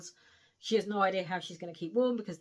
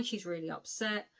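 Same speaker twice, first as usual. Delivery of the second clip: ordinary talk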